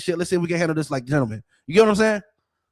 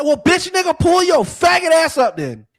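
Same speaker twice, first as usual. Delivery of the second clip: much higher and louder, furious challenge; retold angry shout; 10 semitones higher, 7 dB louder than usual. Daring the neighbor over the phone, a loud furious yell. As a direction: bark it like you're daring him to show up. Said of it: "I said".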